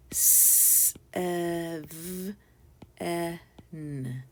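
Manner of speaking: "S, e, v, e, n" as five separate held sounds; sounded out. Shown as a demonstration. Teacher repeats the sounds of seven, "S, e, v, e, n" segmented. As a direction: sound out "S, e, v, e, n" one phoneme at a time. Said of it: "Say the sounds again".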